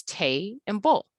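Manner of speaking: "tay" and "bull" said as two separate slow sounds; split into syllables. Shown as a demonstration. Teacher counting the sounds in table, slow split syllables. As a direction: break it into "tay", then "bull", slowly. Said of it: "that has two sounds".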